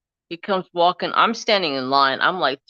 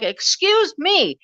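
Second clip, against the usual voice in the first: high, slow, offended excuse me; drawn-out affronted reply; indignant; 14 semitones higher, 3 dB louder, slower than usual. Retold or imitated. Speaker to the manager, mock indignant. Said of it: "And I was like".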